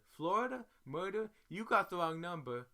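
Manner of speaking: high, loud incredulous "Florida? Murder?"; shocked disbelief. Retold or imitated. On the jail call hearing about murders, shocked shouting. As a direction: shout in horror and shut it down.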